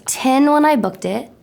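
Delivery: ordinary talk, nothing marked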